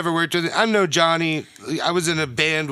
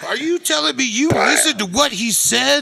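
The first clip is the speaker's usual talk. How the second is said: high, indignant disbelief; incredulous mock scolding; 9 semitones higher, 4 dB louder than usual. Manager questions soundman who believed the singer, mock incredulous. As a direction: berate him as the incredulous boss.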